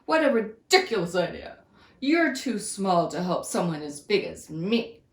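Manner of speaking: loud, mocking lion voice; scornful lion. Lion scoffs at the little mouse, loud and scornful. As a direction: scoff at the silly idea. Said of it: "he said scornfully".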